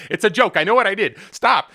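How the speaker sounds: playfully defensive outburst; mock protest. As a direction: fend off mock outrage, loud and high. Mock protest at imagined angry reaction.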